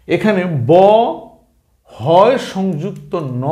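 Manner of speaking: ordinary talk, nothing marked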